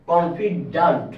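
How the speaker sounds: slow কনফিডেন্ট ending in a long "-dant"; confidant with an A. Shown as a demonstration. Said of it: "এটার উচ্চারণ হচ্ছে কনফিডেন্ট".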